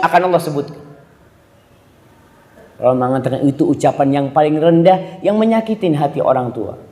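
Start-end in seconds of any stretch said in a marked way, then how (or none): none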